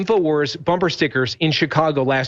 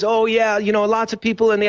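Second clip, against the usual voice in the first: lightly attempted Jamaican accent, pitched up; half-hearted Jamaican accent in a higher voice; terrible Jamaican accent; 5 semitones higher than usual. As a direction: imitate a Jamaican local, badly. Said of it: "I'm doing a terrible Jamaican accent".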